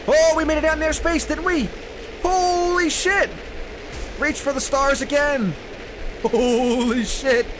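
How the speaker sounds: high, excited cries; real astonished whoop in words; thrilled amazement. Real, not acted. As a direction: exclaim it high, stretched with awe. Goat flung into space again.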